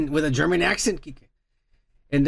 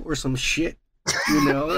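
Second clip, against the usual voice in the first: ordinary talk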